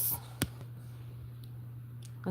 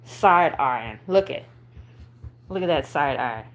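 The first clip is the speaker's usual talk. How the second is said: usual speaking voice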